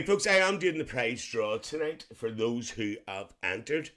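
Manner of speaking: ordinary talk, nothing marked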